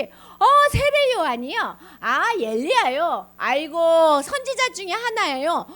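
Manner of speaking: lightly voiced eager disciples; disciples answering. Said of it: "제자들이".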